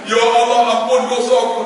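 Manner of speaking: mild wailing prayer; imitated tearful cry. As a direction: plead for forgiveness as if crying. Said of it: "dia teriak".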